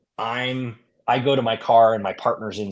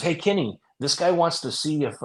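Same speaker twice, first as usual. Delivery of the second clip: higher-pitched retold call to a friend; 6 semitones higher than usual. Partner to Kenny outside the store, calling out. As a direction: lightly imitate a partner hailing someone.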